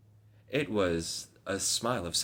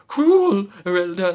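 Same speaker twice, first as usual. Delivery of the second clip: anguished cry; 10 semitones higher, 11 dB louder, slower than usual. Acted. Dying man's cry.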